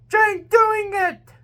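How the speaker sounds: high, slow excited cry; shout. A narrator's reading. Buck shouting in cheer as James fights. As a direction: cheer him on loudly. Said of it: "and Buck shout".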